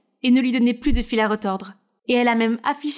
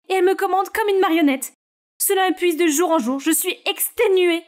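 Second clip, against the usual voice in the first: higher voice, drained and upset; frustrated teen; 7 semitones higher than usual. Acted. Scarlett tells her sister she is exhausted, higher and upset. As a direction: vent how exhausted you are. Photo shoot, then Scarlett breaks down.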